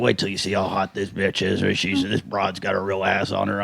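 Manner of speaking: low, soft leering voice; mock sleazy guy. Comedian imitating Craig about the masseuse, low leering. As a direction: lean in low like a creep. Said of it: "and he's like".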